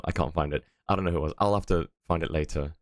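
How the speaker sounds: normal talking voice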